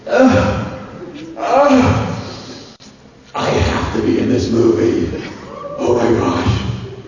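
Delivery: loud ecstatic groans, then the shout; thrilled outcry. Retold or imitated. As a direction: gasp it out like the best script ever. Reaction after reading script.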